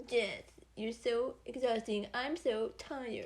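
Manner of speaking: slow, drawn-out weary cartoon voice; sluggish, tired dog impression; Droopy Dog. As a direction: drag it out, worn out. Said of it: "Is that your droopy dog?".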